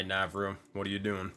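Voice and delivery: ordinary delivery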